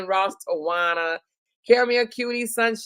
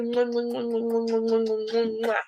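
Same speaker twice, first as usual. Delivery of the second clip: blown kisses; 2 semitones higher, 4 dB quieter than usual. Real, not acted. Blowing kisses to viewer.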